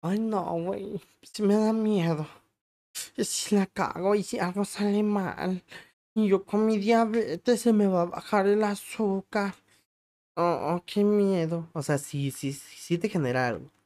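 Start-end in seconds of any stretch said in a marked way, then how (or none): 0.0-11.7 higher, slower, fretting voice of past nerves; imitating his own jittery younger self, higher and slow; mock nervous worrying. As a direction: whine out the worries like a scared newcomer. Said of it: "Al principio sí estaba como".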